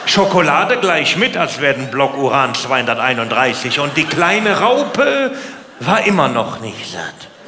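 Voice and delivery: ordinary talk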